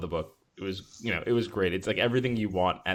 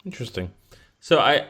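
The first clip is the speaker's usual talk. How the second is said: normal talking voice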